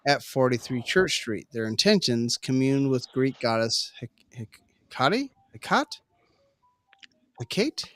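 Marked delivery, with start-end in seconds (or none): 4.9-7.8 Hecate tried different ways, each a question; unsure pronunciation. Real, not acted. Reader stuck on the Greek goddess's name, unsure pronunciation. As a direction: grope for Hecate, ending in kah-tee, kot, then kate. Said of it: "I don't know how to say that".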